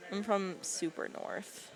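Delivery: ordinary talk, nothing marked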